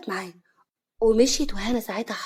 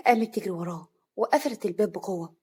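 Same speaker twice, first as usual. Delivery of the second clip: usual speaking voice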